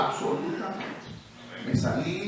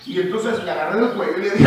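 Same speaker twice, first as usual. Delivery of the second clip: ordinary talk, nothing marked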